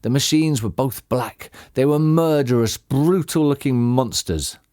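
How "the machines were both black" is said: ordinary delivery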